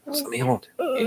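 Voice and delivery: high, gentle coaxing; soothing pet voice. Real, not acted. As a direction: coax the dog softly. Soothing the held dog.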